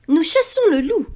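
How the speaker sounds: put-on a kid proud of his hunt; proud boy.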